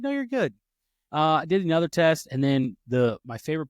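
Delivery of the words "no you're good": a much higher, lightly mocking tester voice; mock-sweet high reassurance; imitating the tester. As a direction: echo her brisk reassurance up high. Tester reassuring him after test.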